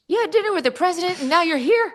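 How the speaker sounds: high, amazed disbelief; a mock-astonished fast-food worker; incredulous drive-thru guy. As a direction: play him stunned you'd come from the president. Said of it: "And he goes".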